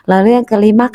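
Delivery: ordinary talk, nothing marked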